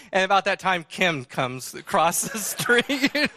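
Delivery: ordinary talk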